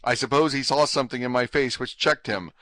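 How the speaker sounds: ordinary delivery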